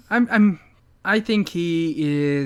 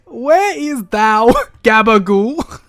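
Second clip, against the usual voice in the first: loud, high, slow mock-archaic boom; mystical D&D character; 4 semitones higher, 7 dB louder, slower than usual. Co-host tops the host's mystic walking line, loud grand mock voice. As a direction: proclaim it like a fantasy mystic. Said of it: "the voice he gave whatever mystical character".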